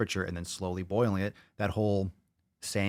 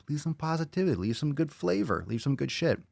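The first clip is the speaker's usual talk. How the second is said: higher, sing-song urging; 7 semitones higher than usual. Real, not acted. Higher pitched encouragement.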